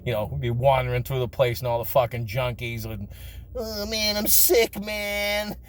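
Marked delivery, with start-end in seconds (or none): 3.6-5.6 lightly voiced strung-out junkie, higher; a hint of a sick addict calling to kids; imitating a junkie, a bit higher. Junkies in the mental institution.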